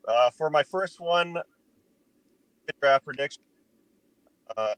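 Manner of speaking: choppy call audio cutting out; breaking up space radio. Real, not acted. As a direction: voice breaking up over a bad line. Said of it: "You're breaking up".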